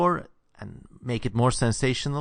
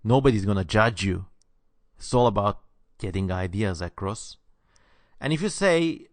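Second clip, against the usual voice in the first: ordinary talk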